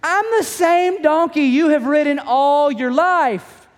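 high, slow indignant donkey voice; talking donkey. Retold or imitated. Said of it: "The donkey says to Balaam".

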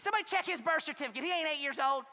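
soccer mom heckle. Retold or imitated. Soccer mom yelling at field.

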